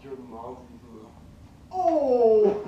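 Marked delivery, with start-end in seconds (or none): 1.7-2.6 high, loud drawn-out "oh". Real, not acted. Gamer reacting to a teasing jab, loud "oh". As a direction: let out a big reacting "oh". Reacting to co-player's mom joke.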